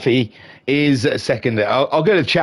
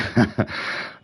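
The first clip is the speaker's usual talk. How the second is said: breathless laugh; 6 dB quieter than usual. Real, not acted. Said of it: "let me catch my breath".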